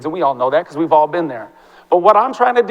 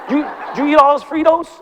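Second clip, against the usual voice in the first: higher-pitched excited student imitation; mock pumped-up student; 8 semitones higher than usual. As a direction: tease like an excited kid, pitched up. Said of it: "they're all pumped up".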